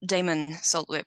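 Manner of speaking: usual speaking voice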